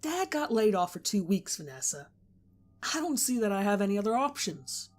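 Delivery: still upset. A narrator's reading. Daniel justifying himself to Vanessa, bitter and loud. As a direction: push through the anger.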